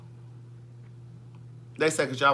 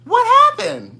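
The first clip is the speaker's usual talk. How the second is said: much higher, louder mock question; mocking viewers; 11 dB louder, slower than usual. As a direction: ask it like a clueless viewer. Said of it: "that's how they talk".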